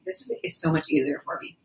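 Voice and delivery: soft unvoiced whisper. Real, not acted. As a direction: whisper it close to the mic. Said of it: "Is, is this okay?".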